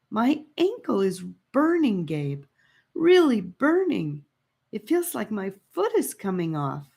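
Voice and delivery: put-on high girl's voice, hurting and begging; pained pleading. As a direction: beg through the burning pain. Annika injured, begging Gabe.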